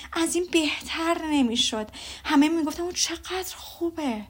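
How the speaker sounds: a mother bursting with ball gossip; telling her husband all about the ball, gushing mother; gushing Mrs Bennet. Acted.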